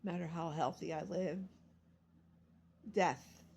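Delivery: ordinary delivery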